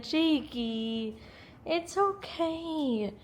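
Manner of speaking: soft, high, drawn-out coo; soothing pet talk. Real, not acted. Pet talk to scared Jake.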